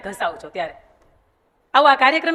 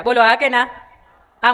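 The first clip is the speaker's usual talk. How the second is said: ordinary delivery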